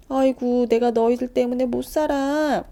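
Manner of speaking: loud, exasperated scolding; exasperated mother. Acted. Mother scolding her sons, loud exasperated groan. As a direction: groan it at the kids, fed up.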